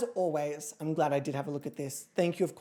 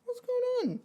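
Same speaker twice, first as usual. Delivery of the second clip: high coo to a pet; high pet talk; slower than usual. Real, not acted. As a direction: check on your cat in a high little voice. Talking to the cat.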